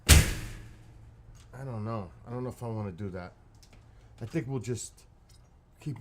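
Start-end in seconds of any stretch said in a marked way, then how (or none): 0.1-2.1 very slow, loud, drawn out. Real, not acted. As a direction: drag the words out slowly.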